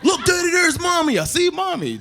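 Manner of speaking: put-on high, sing-song child voice; excited little kid. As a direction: squeal it like a five-year-old spotting a truck. Son voiced again.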